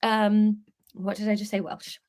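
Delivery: plain reading voice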